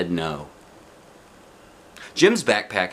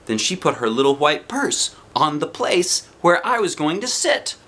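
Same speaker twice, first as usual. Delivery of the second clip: ordinary delivery, nothing marked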